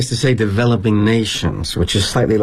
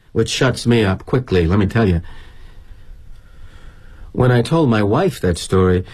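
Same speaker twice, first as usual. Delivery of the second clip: ordinary delivery, nothing marked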